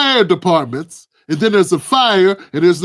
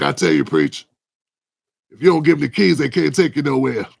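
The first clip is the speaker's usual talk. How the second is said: a hint of a lower old man's voice from the block; Crab's voice; 5 semitones lower than usual. Old guy Crab advising the young narrator, lightly voiced lower. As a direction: lean down low like the old head on the corner. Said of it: "'Cause he talk like this".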